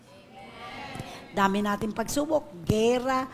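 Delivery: ordinary talk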